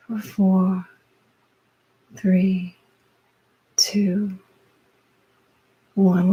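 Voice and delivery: slow, spaced-out breathing cues with drawn-out words; guided breathwork. Real, not acted. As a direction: lead the breath, pausing after each cue. Leads a guided breathing exercise.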